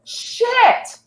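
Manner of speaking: high, loud Southern drawl of "Shit!" for "sit"; loud Southern accent. Retold or imitated. Old Southern woman repeating a word test, loud Southern accent. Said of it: "she just very loudly said ... in her deep Southern accent".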